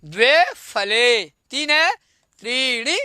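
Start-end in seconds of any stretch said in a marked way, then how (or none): 0.0-1.2 द्वे फले said slowly and clearly; teacher's slow repeat-after-me; slow model answer. Shown as a demonstration. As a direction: spell it out for the children. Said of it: "क्या बोल देंगे?".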